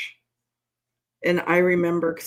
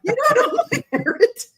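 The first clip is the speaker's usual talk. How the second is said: high, laughing mock outrage; pitched-up giggling mock outrage. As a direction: laugh through the indignant line. Laughing repeat of outraged quote.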